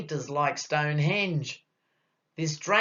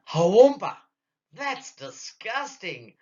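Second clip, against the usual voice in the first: screamed in disgust; 5 semitones higher, 5 dB louder than usual. Acted. Patient reacting to the doctor's glove, hammed-up disgusted scream. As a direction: burst out loud, then squeal in revulsion. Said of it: "So I screamed".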